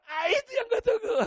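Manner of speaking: high, loud cry of delight; gleeful outburst. Retold or imitated. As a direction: burst out happily, half laughing.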